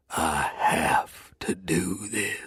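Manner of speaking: slow broken whisper; tearful whisper. Acted. Hero to Bethany, a slow tearful whisper. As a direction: whisper it through tears. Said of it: "I tell her".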